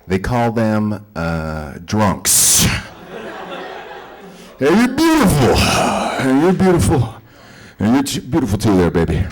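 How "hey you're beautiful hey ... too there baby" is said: put-on sloppy drunk hitting on women, much higher and fast; drunk guy. Drunk calling out to a woman at a bar.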